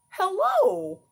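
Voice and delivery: high puppet hello; chirpy puppet greeting. Acted.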